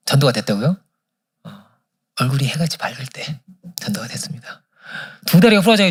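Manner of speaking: ordinary talk, nothing marked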